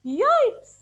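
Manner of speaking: high, loud happy squeal; joyful high yelp. Acted. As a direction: squeal it with delight. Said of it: "Termite happily".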